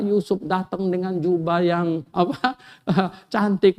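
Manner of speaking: ordinary talk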